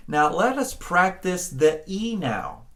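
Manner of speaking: ordinary talk, nothing marked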